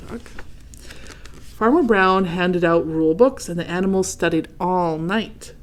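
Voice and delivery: plain reading voice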